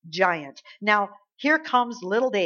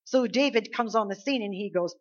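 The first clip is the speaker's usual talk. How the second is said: ordinary talk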